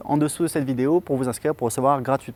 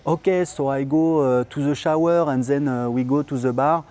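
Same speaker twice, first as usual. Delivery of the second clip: put-on slow, heavy French-accented English; English spoken with a thick French accent, slowly; strong French accent in English; 4 dB louder, slower than usual. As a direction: mimic a newcomer's clumsy French-accented English. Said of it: "accent vraiment anglais de base, mauvais".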